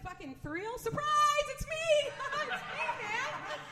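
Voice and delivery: high, giddy shout with laughter; spring the surprise, delighted; gleeful surprise reveal. Pretend. Surprise reveal to catfished date.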